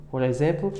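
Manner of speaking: ordinary talk, nothing marked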